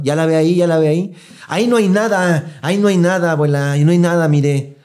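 frustrated grandson arguing with grandma; exasperated yelling. Retold or imitated. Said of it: "ya todos estaban gritando".

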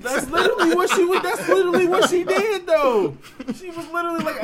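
high, loud, laughing. Real, not acted. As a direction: laugh through the words.

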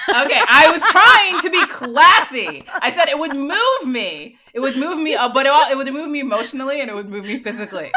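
high and loud, laughing it out; laughing protest. Real, not acted. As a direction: defend yourself through giggles.

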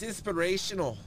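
high-pitched, lightly voiced catchphrase; Tom. Friend's saying tossed into banter, high mimicry. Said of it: "as Tom would say".

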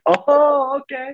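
drawn-out, very high mock "oh"; impressed. Gang member learning his wife is Mexican, impressed and high. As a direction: imitate someone suddenly won over, voice soaring.

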